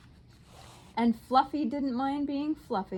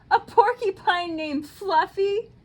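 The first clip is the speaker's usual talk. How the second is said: high, loud laughing howl; howling with laughter; 9 semitones higher, 7 dB louder than usual. A narrator's reading. Rhinoceros howling with laughter at the porcupine's name. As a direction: shriek it through laughter. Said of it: "howled the rhinoceros".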